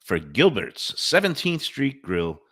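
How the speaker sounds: upbeat radio ad read. Acted. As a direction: sell it like a radio spot.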